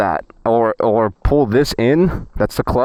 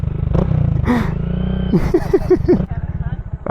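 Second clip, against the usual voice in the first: breathy laugh; 13 semitones higher than usual. Real, not acted. Laughing at his own bit.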